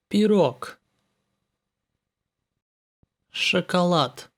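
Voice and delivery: Дуб, Остров, Пирог with the last voiced consonant devoiced; Нож and Мороз ending voiceless; final devoicing. Shown as a demonstration. Teacher demonstrates final devoicing to students. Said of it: "The last twin consonant in the word loses its voice".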